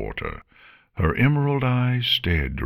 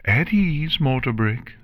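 high-pitched, measured command; lightly projected royal command, pitched up; calling out; 8 semitones higher than usual. A narrator's reading. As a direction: call out an order to stand down. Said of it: "Luna called out".

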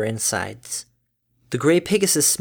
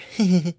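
light laugh; 6 semitones higher than usual. Acted. Mother laughs after joke.

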